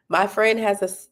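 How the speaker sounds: ordinary talk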